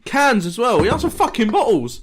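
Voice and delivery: high, loud outraged rant. Real, not acted. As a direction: complain with real indignation. Outraged complaint about order.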